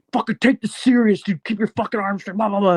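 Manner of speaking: hammed-up angry dad yelling at his kids; imitating angry dad. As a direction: bark it like a dad scolding sloppy bowling. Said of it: "my dad was so pissed off at us".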